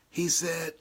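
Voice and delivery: usual speaking voice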